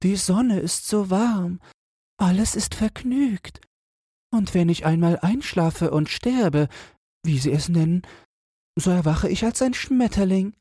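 very high, dreamy little creature voice; contented caterpillar. Acted. Caterpillar dreams of becoming butterfly. (selige Kohlraupe, ganz hohe Stimme)

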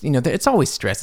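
normal talking voice

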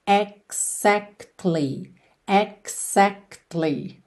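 "Exactly" very slow, syllable by syllable; careful pronunciation. Shown as a demonstration. Teacher demonstrates the word for learners, very slow and separated. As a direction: break "Exactly" into drawn-out "eg-zakt-lee". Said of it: "Atenção aí à pronúncia dessa palavra".

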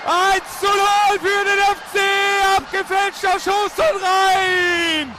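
ecstatic goal call, shouted high; screaming goal commentary. Real, not acted. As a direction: yell the goal like a radio commentator. Radio goal call, Cordoba scores.

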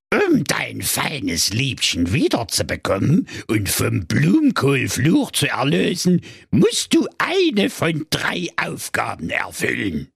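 put-on old fairy-tale witch. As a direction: lure them in like a gingerbread witch. Witch sets tasks. (alte Hexe an der Gegensprechanlage)

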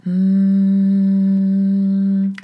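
a steady held hum; humming demo. Shown as a demonstration. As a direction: hum a long even tone to show it. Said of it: "oder summen". (gleichmäßiges Summen)

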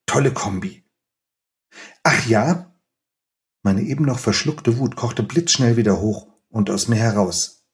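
measured, expressive reading aloud of a scene; story reading. Acted. Author reading his novel to the interviewer, expressive storytelling. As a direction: read the chapter to the audience with feeling.

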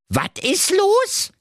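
put-on high, slow Berlin-accent baffled question; astonished. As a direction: gape at him in disbelief. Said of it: "fragte ... erstaunt".

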